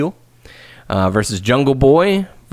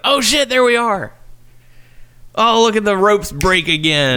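imagined excited rewatch; 9 semitones higher than usual. Pretend. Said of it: "be like".

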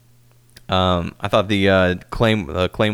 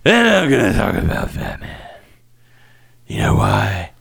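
gruff Batman impression; 5 semitones higher than usual. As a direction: growl it like Batman. Doing a Batman voice for Ambrose.

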